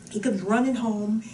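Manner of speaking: ordinary talk, nothing marked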